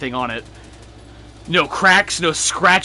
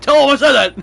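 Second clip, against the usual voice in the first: high, loud playful shout; mock-insistent yell; 9 semitones higher, 9 dB louder than usual. Friend urging Andy to relay a message to his father, mock yelling. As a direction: yell it as an escalating demand. Repeats the demand, escalating to a yell.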